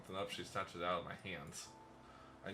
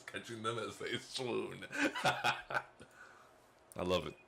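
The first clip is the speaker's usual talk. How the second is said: laughing; 4 dB louder, much slower than usual. Acted. Gloating over his own charm.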